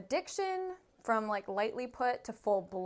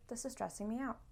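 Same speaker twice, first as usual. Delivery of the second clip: much softer, contained admission of stress; calm admission; 10 dB quieter, faster than usual. Shown as a demonstration. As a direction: say it quietly, keeping composed. Models a calmer way to say it.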